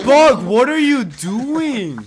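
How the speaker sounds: high, loud, stretched-out disbelief; drawn-out outcry. Real, not acted. Player calling out Bug's blunder, drawn-out outcry. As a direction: wail it slowly at your teammate.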